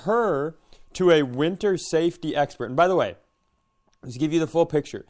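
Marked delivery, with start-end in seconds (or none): none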